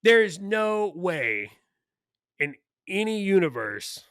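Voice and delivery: NO WAY and ANY stretched out, high and loud; slow, punched words with long pauses; emphatic disbelief. Real, not acted. Host rebutting a pundit's media claim, emphatic disbelief.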